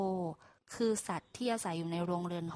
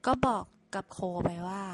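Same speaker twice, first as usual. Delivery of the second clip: ordinary delivery, nothing marked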